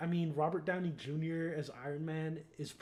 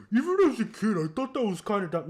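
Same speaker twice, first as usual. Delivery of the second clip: loud, higher mock commenter voice; mocking imitation; 6 semitones higher, 11 dB louder than usual. Speaker parodying people in YouTube videos, mocking imitation. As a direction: put on a loud, derisive imitation of a know-it-all.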